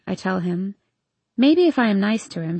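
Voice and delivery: ordinary delivery, nothing marked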